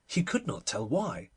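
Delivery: ordinary delivery, nothing marked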